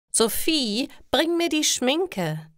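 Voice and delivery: deutlich höher, fordernd wie ein verwöhntes Mädchen; herrische Ingrid. Acted. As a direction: befiehl es von oben herab, hohe Stimme. Ingrid fordert, Kinderstimme vorgelesen. (hoch und herrisch, Ingrid kommandiert ihre Freundin)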